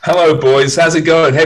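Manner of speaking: big booming hello to the hosts; loud hearty greeting. Real, not acted.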